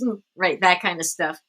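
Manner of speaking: normal talking voice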